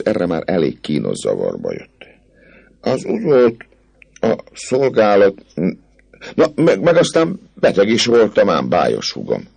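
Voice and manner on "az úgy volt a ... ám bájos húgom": hesitant, stumbling officer, raised voice; embarrassed captain. Acted. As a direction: fumble for an excuse. Captain stammers excuses. (zavarban hebegő kapitány)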